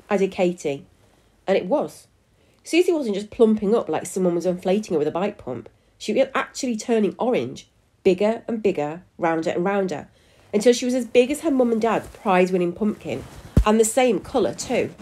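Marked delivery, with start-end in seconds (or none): none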